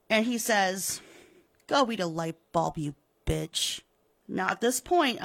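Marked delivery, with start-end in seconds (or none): 1.7-3.8 low, soft contemptuous sneer; sneering dismissal. Acted. Pratt sneering after the widow hangs up. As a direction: toss it off with contempt.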